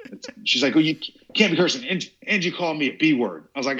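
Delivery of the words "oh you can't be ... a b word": retold scolding. Woman scolding his cursing.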